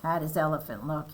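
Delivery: ordinary delivery, nothing marked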